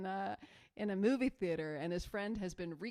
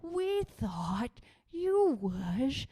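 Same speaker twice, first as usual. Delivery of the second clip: mock astonished; 12 semitones higher, 10 dB louder, much slower than usual. Friends respond in movie scene.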